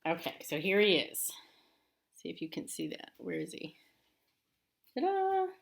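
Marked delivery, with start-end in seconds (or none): 4.9-5.5 high, loud sing-song Ta-da!; ta-da reveal. Acted. Teacher showing the narwhal picture to kids, playful ta-da. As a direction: unveil the picture like a magic trick.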